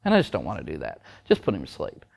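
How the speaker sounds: low, soft imitation of trainers; mock dismissive. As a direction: give up casually, dropping low and quiet. Mimics trainers casually giving up.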